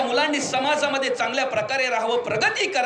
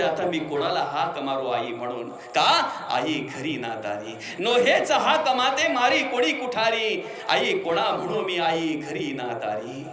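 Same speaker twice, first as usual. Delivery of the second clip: grieving; 10 semitones lower, 4 dB quieter than usual. Real, not acted. Grieving for dead mother.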